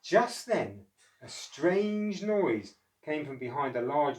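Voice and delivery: slow, mysterious read-aloud; suspenseful storybook narration. Acted. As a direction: build suspense for the children.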